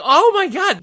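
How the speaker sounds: high-pitched imitation of amazed fans; excited recognition. Fans in line learning who the interviewer is, excited recognition.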